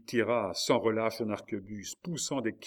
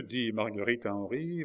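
ordinary delivery, nothing marked

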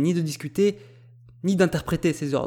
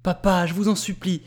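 higher-pitched plea, without real sobs; tearful imploring; 4 semitones higher than usual. A narrator's reading. Daughter begs her father to stop fighting, imploring. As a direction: implore with rising urgency. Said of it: "implorait Jenny tout en pleurs".

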